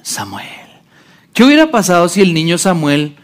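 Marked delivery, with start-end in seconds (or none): 0.0-0.5 soft night call. Acted. God's voice calling boy at night.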